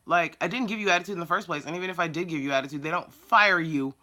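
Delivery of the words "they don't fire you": usual speaking voice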